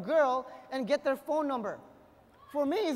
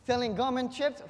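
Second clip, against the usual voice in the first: ordinary delivery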